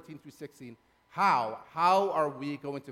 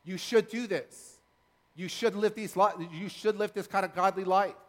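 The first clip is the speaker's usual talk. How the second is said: raised, emphatic preacher's charge; Paul's insistent charge; 5 semitones higher, 5 dB louder than usual. Acted. Preacher quoting Paul's commands to the church, insistent. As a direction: press each command home, higher and louder. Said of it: "Paul was saying".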